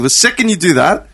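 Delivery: usual speaking voice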